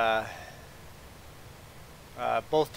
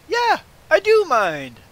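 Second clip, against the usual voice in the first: loud, high, drawn-out retort; acted-out forceful, affronted refusal; retold indignant objection; 11 dB louder, slower than usual. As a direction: stand your ground loudly, stretching each word. Retelling confrontation with line cutter.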